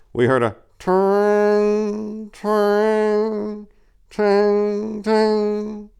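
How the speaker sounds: high, drawn-out sung twang, like a plucked note; twanging horn sound. Retold or imitated. Narrator mimicking the thawed horn in the inn, high twangs. Horn's frozen notes thawing out.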